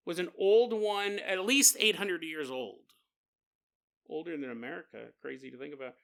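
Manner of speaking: usual speaking voice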